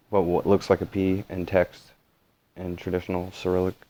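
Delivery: normal talking voice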